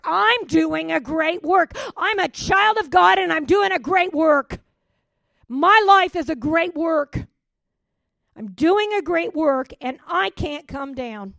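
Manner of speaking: high, loud, emphatic proclamation; triumphant refrain belted out; preaching shout. Real, not acted. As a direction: declare it with full force. Congregation declaration, preaching.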